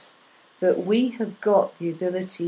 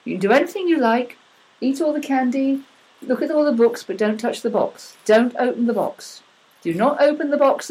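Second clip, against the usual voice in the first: put-on higher, louder parent warning a child; 5 semitones higher, 4 dB louder than usual. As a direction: warn the kids off the box, insistently. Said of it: "if you say to that child".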